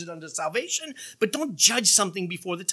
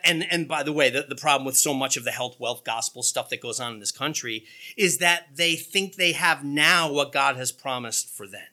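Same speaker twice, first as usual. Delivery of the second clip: ordinary talk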